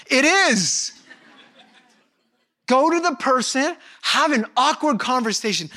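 emphatic preaching, slowed on the command; preaching at full force. Real, not acted. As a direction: push the point home to the congregation.

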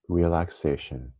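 ordinary talk

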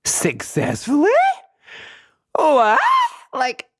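high, slow, loud incredulous gush; amazed excitement. Real, not acted. Telling listeners about her first meditation, high and thrilled. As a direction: marvel that she actually pulled it off.

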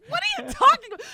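loud, high, incredulous; retold shout of disbelief; mock exasperated wife. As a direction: shriek it in disbelief. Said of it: "I'm just like".

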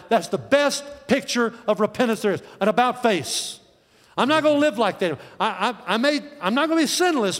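high, insistent pulpit delivery; impassioned preaching. Acted. Preacher urging the congregation to turn around, impassioned. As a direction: preach it hard, voice lifted and driving. Preaches at full force on repentance.

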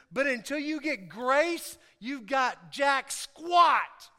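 slow, emphatic pulpit delivery building to a shout; preaching. Real, not acted. Preacher says it starts with grace, emphatic preaching. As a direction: punch each line out to the room.

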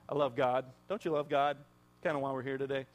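normal talking voice

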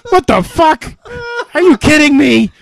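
high, loud, exasperated outburst; mock outrage. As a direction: yell it like the dream just betrayed you. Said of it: "and I'm like".

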